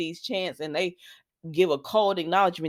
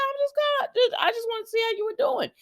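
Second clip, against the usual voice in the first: mock Jeremy. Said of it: "And he said".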